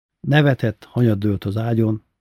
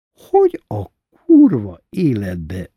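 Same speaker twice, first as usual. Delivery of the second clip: slow, loud, drawn-out curse; incredulous swearing; 8 dB louder, slower than usual. A narrator's reading. Mackó asking Sanyó bácsi how on earth, incredulous swearing. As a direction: stretch the swear words out loudly.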